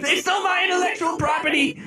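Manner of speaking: high-pitched hammed-up panic; mock outrage. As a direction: shout as if playfully scandalized. Mock outrage at stolen tune.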